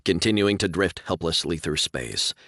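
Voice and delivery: usual speaking voice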